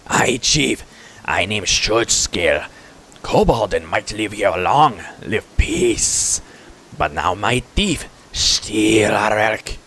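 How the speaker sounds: slow, halting creature voice; put-on kobold chief, deliberate and slow. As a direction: tell it in laboured pidgin, stretching words.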